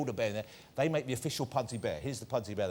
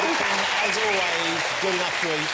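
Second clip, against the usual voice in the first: showman guest introductions; 6 semitones higher, 5 dB louder than usual. Acted. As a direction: announce each guest like a big-night showman. Host introduces guests, shouting names.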